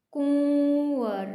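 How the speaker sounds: कुंवर drawn out slowly with a nasal u; slow, stretched कुंवर; nasal vowel demo. Shown as a demonstration. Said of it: "कुंवर के अंदर आपको लगेगा कि न तो मैं की है".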